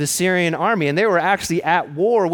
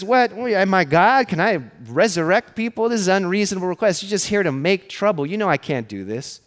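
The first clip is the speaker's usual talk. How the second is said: high, rapid-fire protest of an overwhelmed king; mock outrage, pitched up, easing at the end; distraught king; 5 semitones higher than usual. King of Israel protests an impossible request, acted distraught. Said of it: "tears his clothes and says".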